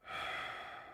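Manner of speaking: soft unvoiced shaky exhale; shaky sigh. A narrator's reading. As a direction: breathe out, shaken. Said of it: "let out a shaky breath".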